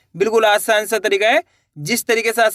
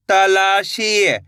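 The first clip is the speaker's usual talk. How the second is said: slow blending; much slower than usual. Shown as a demonstration. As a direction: draw the word out slowly, syllable by syllable. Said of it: "इसको इस तरीके से पढ़ लेना है". (तकलीफ को बहुत धीरे खींचकर बोलें)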